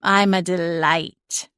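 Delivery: slow, dry talking-cat drawl; deadpan self-praise, drawn out; cat's drawl. A narrator's reading. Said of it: "Celine drawled".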